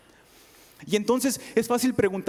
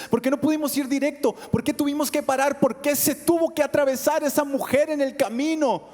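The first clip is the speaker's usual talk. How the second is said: rising, anguished questions; desperate pleading; 7 semitones higher than usual. Acted. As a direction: Jairus breaking inside, asking why. Jairus's desperate inner questioning.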